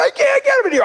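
very high, panicky mock squeal; squeaky, hammed-up nervous protest; nervous child. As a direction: imitate a flustered kid in a panic. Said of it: "I was so nervous".

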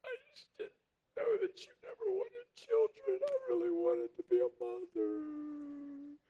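high, tearful crying voice; crying wife. Retold or imitated. Wife crying to husband about wanting a baby, high and tearful. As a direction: sob it out as the heartbroken wife. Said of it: "She's like".

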